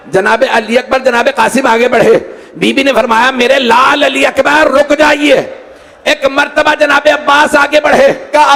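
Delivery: high mourning narration, climbing steadily; impassioned majlis storytelling; lamenting preacher. A narrator's reading. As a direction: build the grief with every line. Grief narration at rising force.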